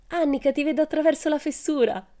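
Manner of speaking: high-pitched call to a friend; gleeful call. A narrator's reading. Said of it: "gridò Pippi".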